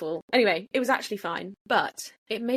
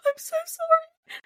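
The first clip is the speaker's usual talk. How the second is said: much higher, slow, tearful voice; retold crying apology; 5 dB louder, slower than usual. Apologising to the porter through tears, high and slow. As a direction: sob out the apology. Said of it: "I just literally burst into tears".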